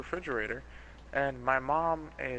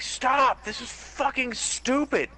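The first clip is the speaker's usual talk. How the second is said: retold furious scream, much higher and louder; screaming at family; 12 semitones higher, 5 dB louder, slower than usual. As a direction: yell it at the whole fighting family. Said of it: "I'm screaming at everyone".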